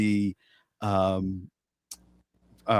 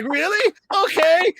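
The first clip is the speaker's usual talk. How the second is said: high, squeaky really, then okay; mock surprise; 3 dB louder, slower than usual. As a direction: play a startled studio exec. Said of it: "So he was like".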